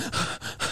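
breathless starstruck gasp, voiceless; imitated gasp. Mimicking an adult fan meeting Minnie, voiceless gasp. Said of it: "she was going, like this".

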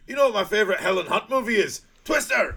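high, loud, quick setup into a yell; hammed-up shout. Wrestler taunting his opponent, hammed-up high shout. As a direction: build to a shouted punchline. Acted taunt during sumo bout.

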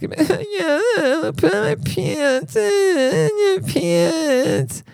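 put-on very high, slow, soft whining child's voice; whiny kid. Kid whining about putting on pants, high and slow. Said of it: "It couldn't talk in a normal voice".

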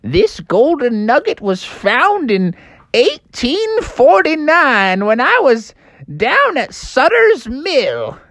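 put-on high, slow old miner; old prospector. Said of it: "And he said".

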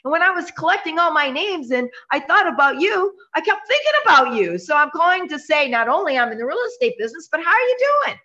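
high, friendly sales call voice; demo phone call. Shown as a demonstration. Agent demos calling an old friend, cheerful and high. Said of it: "You learn how to say".

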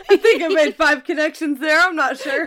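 laughing at own fumble. Real, not acted. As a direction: crack up while admitting the mess.

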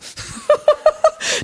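high laugh. Real, not acted. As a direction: burst of high-pitched giggling. Laughs after aside. (hohes Lachen)

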